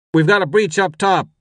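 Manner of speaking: high, loud radio report; urgent father. A narrator's reading. Father warns the deputy of a breach, urgent. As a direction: report the emergency over the din. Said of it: "his father said".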